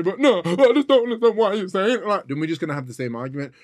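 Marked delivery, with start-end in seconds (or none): 0.0-2.1 mocking her objection. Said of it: "spend six hours telling me".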